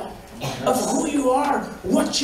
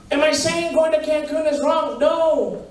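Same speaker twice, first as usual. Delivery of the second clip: ordinary delivery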